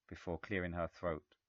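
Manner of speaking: plain reading voice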